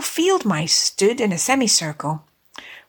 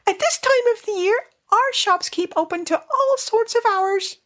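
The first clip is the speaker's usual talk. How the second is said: high-pitched, eager-to-help little mouse; respectful field mouse; 12 semitones higher than usual. Acted. Field mouse tells Rat about the shops, respectful high voice. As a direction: explain politely in a tiny high voice. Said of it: "replied the field mouse respectfully".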